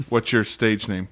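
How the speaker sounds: normal talking voice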